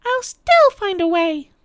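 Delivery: high, loud, defiant witch voice; screeching witch. A narrator's reading. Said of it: "the witch screeched".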